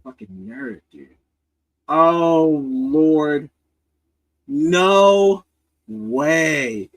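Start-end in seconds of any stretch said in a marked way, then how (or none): 1.9-6.9 shocked no way; surprised. Real, not acted. Reacting to shooter photo.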